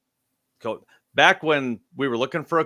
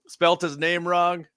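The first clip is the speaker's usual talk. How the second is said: puzzled, higher-pitched mock googling; baffled disbelief; 5 semitones higher, 9 dB louder, slower than usual. Fan googling the newly signed head coach, baffled. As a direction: drag the name out in confusion, half laughing. Acts out his baffled reaction to the news.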